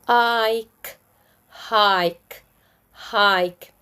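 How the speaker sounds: "hike" with a drawn-out "eye" vowel; long I. Shown as a demonstration. Teacher shows magic E words, long I. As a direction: stretch the "i" in "hike". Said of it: "With magic E".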